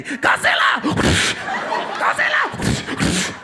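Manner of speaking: high-pitched mock movie-fighter yell; crazy yell. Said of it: "he would yell out something crazy".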